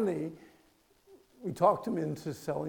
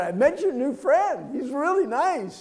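louder and slower, as if to someone hard of hearing; loud to hard-of-hearing grandma; 10 semitones higher, 12 dB louder than usual. Retold or imitated. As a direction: say it loud and slow so grandma catches every word. Talking loudly to elderly grandma.

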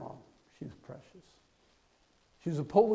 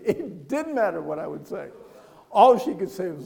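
ordinary delivery

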